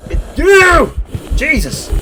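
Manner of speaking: loud high jump-scare shriek; startled yell. Real, not acted. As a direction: yell out in real shock. Startled by ghost event in game.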